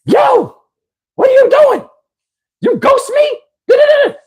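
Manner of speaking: shrill-high squeaky imitation of the woman on the phone; mock her way up high, ranting. Caller ranting at the narrator over a paid dinner, squeaky falsetto. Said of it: "She gets on the phone".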